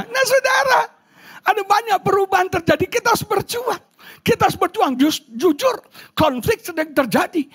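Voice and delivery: high, urgent pulpit exhortation; rousing preaching. Acted. Preacher urges congregation to fight through changes, high rousing preaching. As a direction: lift the voice high and rally them.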